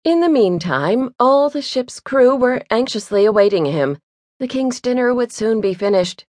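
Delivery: ordinary delivery, nothing marked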